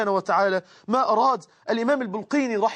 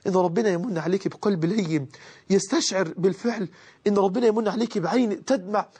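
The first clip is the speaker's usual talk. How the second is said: ordinary talk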